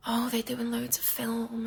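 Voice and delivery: whispered confession. Real, not acted. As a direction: hush it down like a secret.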